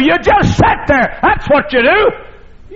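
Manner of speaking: preacher thundering to the congregation to be still; preaching shout. Acted. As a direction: drive the point home with a slow shout.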